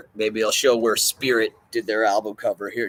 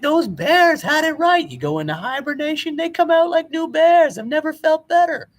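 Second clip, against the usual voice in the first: Lewis Lewis; 5 dB louder than usual. Retold or imitated. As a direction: stretch it out like a man reborn from hibernation. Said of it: "He's like".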